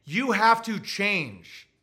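slow, drawn-out order; mock Cuomo, slow and condescending; lecturing Cuomo. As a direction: talk down to him slowly. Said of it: "he's saying".

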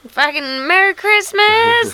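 put-on high, slow drunken holiday cheer; town drunk. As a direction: slur it like a tipsy old Santa. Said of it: "if I was a seventy-three-year-old mall Santa".

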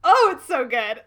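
very loud, high, delighted outburst; gushing excitement. Real, not acted. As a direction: gush with a laugh in it. Gushing over a favourite scene.

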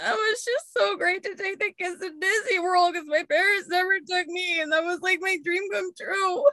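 high, sobbing self-parody; wife crying over Disney memories, hammed-up tears; mock teary rant. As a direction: whine it like you're crying over nothing. Retelling her own tearful rant.

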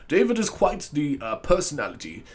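put-on English accent, slowed down; mock English celebrity tribute; Stephen Fry impression. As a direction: attempt a measured English accent, starting high. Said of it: "Stephen Fry or someone being like".

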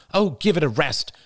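high, irritated dismissal; snapped. A narrator's reading. Diane fed up with Jada's tirade, irritated snap. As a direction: snap at her to stop. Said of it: "snapped Diane".

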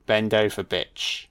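put-on English accent sinking to a soft, voiceless last word; mock English accent, dropped to a breath at the end. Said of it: "My accent".

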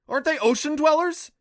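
high, loud, alarmed question; screeching disbelief. A narrator's reading. Adam baffled by jellyfish in a river, screeching. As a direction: keep screeching as you ask. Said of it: "Adam screeched".

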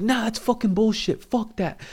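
high, mocking angry outburst; imitated angry rant. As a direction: play the guy losing it over a call. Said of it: "he starts going off".

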